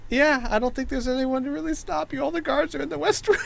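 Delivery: high, giggly explanation; talking through laughter. Real, not acted. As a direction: keep narrating while cracking up. Game master still laughing while narrating.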